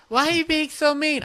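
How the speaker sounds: put-on high, whiny girl voice; mock hurt girl. The girl friend complaining he is mean, high whiny mock voice. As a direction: pout it like a wounded girl friend.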